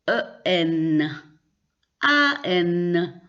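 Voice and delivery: ordinary talk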